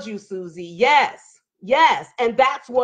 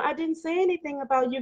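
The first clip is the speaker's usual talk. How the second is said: softer, quick, timid teacher's reply; meek and humble; 4 semitones higher, 7 dB quieter, faster than usual. Retold or imitated. Retelling the punched teacher's reply, meek and small. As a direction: play the hurt teacher shrinking back meekly. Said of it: "very meek and humble".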